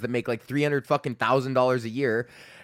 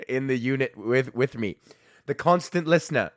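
normal talking voice

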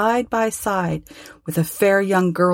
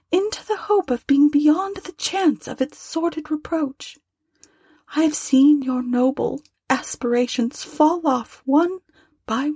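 put-on higher, gentle voice of a grieving young woman; young girl, sorrowful; 9 semitones higher than usual. A narrator's reading. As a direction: lift into a tender girl's voice, close to tears.

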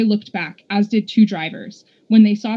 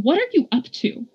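higher, irritated demand; cross, pitch-raised challenge; mildly snapping; 7 semitones higher than usual. A narrator's reading. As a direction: question it with mild exasperation. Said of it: "snapped Polly M".

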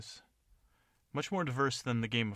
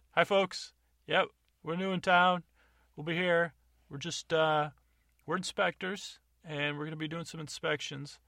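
higher, louder inspector addressing the room; loud, pushy town inspector; official announcement; 6 semitones higher, 4 dB louder than usual. Acted. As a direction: announce yourself to the whole bar. Player acts inspector addressing crowd.

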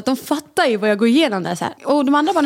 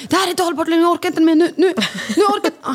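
higher, pleading, at the end of her rope; mock breakdown, voice climbing and cracking into a laugh; retelling a desperate plea to her partner, climbing high; exasperated outburst; 7 semitones higher than usual. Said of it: "jag sa till henne senast igår".

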